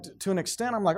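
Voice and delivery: ordinary talk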